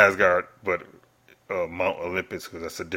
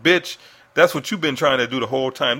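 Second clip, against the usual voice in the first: loud, higher-pitched indignant reaction; raised voice, calling someone out; exasperated outburst; 6 semitones higher, 9 dB louder than usual. Real, not acted. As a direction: burst out at the villain in disbelief. Outburst at the villain's quoted speech.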